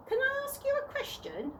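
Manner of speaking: proudly insisting she's nearly grown; girl insisting. A narrator's reading. Chloe to the tramp, insisting on her age, eager girl voice.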